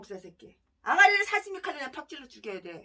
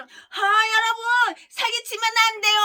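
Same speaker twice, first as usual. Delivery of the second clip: higher, slow, tearful mock-sermon cry; mocking pulpit voice; 5 semitones higher, 5 dB louder, slower than usual. Pastor preaching to his congregation, hammed-up tearful cry. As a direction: ham up a weeping preacher at the pulpit. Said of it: "강대상에서는".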